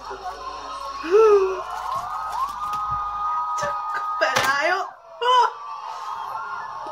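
long high shrieking gasp. Real, not acted. Loud fan gasp-shriek.

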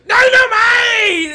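high, loud, drawn-out mock yell; re-enacted holler. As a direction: holler it slowly, hammed up. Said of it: "and I said".

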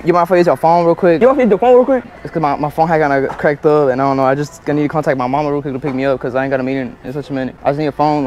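ordinary talk

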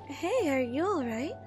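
normal talking voice

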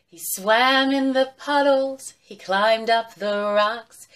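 slow, sing-song recitation; drawn-out rhythmic nursery verse; children's rhyme. Acted. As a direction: chant it in measured beats for little ones.

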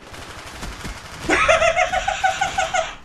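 very high, long laugh; gleeful cackle. Real, not acted. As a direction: cackle uncontrollably in victory. Gleeful at kills.

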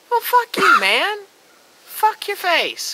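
high, loud outburst, then a slow "Fuck your face"; furious shout. Real, not acted. Fan yelling at whiny villains, an angry shout. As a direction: blow up at them, full volume.

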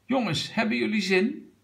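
high, eager question; calling out. A narrator's reading. Grandpa asks the children if they are keen, calling out. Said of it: "riep opa Brom".

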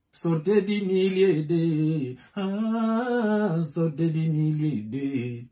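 slow, high, sung-out call to come out; drawn-out call. Acted. Preacher to the church, drawn out and sung high. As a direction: stretch each word like a chant.

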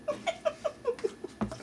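a tiny squeal of a laugh, high and soft; high squeaky giggle. Real, not acted. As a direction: giggle high up, barely voiced. Laughing at spilled rice.

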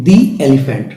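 "the elephant" with the as a clear "dee"; "thee" before vowel. Shown as a demonstration. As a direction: rhyme "the" with see before "elephant". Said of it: "তাহলে এটা vowel হবে".

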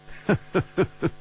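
high, staccato laugh; amused chuckle. A narrator's reading. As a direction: chuckle in short bursts. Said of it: "齐冰燕笑着说".

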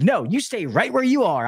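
high, swooping mock command; wife going off. Wife stops the technician stepping outside, high-pitched going off. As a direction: imitate an angry wife ordering someone to stay. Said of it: "she was going off".

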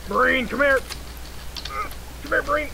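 high-pitched call to someone. Real, not acted. Player calls a marine over in the game, high calling voice.

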